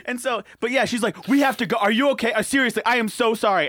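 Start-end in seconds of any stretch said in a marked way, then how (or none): 1.3-3.7 high, loud imitation of frantic concern; panicking. Friend apologizing to injured player, panicked plea. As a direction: plead urgently, mock-panicked. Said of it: "like, starts panicking".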